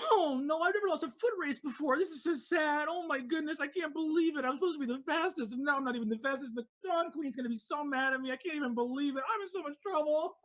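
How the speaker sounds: high, fast whiny lament; high-pitched sulky loser; sad racer. Acted. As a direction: whine about losing the race. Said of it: "He goes like".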